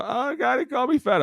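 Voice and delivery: high whiny mock voice for a sulking rookie; whiny mock hurt. Teammate mocking a rookie who sulks about being called fat, high whine. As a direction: pitch way up, whine it like a crybaby.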